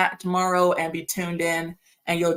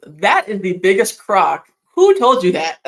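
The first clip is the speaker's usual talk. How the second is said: indignant outburst; 9 dB louder than usual. Real, not acted. Outraged at viewer's birth claim.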